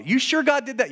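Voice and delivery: high-pitched questioning; incredulous challenge. Acted. Preacher questions the people's claim about God, high and incredulous. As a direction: pitch it up in acted disbelief.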